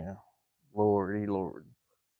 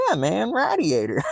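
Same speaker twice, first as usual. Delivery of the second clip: higher "Yeah, man, radiator"; playing the local, shaking his head at you; mock local insisting; 9 semitones higher than usual. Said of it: "he's shaking his head at me".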